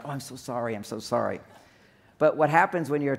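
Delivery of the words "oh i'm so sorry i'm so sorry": soft, low-key mock commiseration; imitated sympathy. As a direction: gently imitate one woman consoling another. Woman comforting another, acted.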